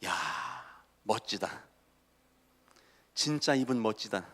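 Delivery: low, very soft murmur of awe; hushed self-admiration. Acted. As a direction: admire yourself privately in the mirror. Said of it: "어떤 마음이 드시나?". (낮은 목소리로 감탄하듯)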